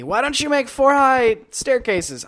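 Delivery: high, loud, slow mock nag; imitating a yelling friend. Imitating B-Ran yelling at him about staircases. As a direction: ham up a friend's nagging. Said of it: "Was yelling at me".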